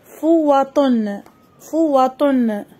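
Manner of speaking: slow teacher's dictation of فوة; slow model reading. Shown as a demonstration. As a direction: say فوة slowly and clearly for children. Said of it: "المقطع الأول والذي يوجد به حرف الطاء".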